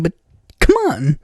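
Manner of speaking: ordinary delivery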